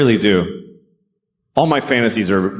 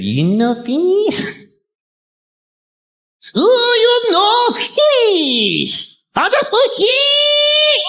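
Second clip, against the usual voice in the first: put-on high, slow, shrieking caricature accent; exaggerated Japanese accent; slower than usual. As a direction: wildly overdo the Japanese waitress. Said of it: "No, it was subtle".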